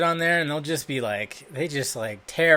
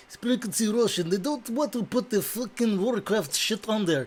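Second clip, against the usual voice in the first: higher put-on Russian accent, hammed up; mock Russian accent; 7 semitones higher than usual. Vlogger mocking a Russian video site, hammed-up Russian accent. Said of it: "That's my, uh, Russian accent".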